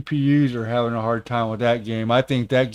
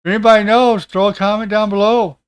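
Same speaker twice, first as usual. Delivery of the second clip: raised, higher call to the audience; 7 semitones higher, 6 dB louder, faster than usual. Real, not acted. Streamer asking viewers for answers, calling out. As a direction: throw it out loud and high to everyone.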